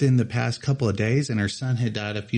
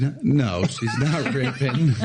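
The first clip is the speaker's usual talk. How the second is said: slow words through a real laugh; laughing it out, drawn out. Real, not acted. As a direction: crack up while saying it. Amused by the co-host's joke.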